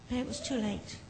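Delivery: plain reading voice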